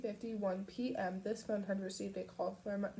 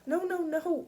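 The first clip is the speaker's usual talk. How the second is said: rushed, high and louder; quick; 8 semitones higher, 7 dB louder than usual. A narrator's reading. Girlfriend stopping him, quick and urgent. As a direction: cut him off fast. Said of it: "Pip said quickly".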